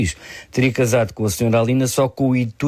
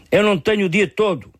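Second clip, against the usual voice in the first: indignant judge, pitched much higher; indignant cry; 12 semitones higher than usual. A narrator's reading. Judge losing patience in court, indignant high-pitched cry. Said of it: "a juíza também gritou indignada".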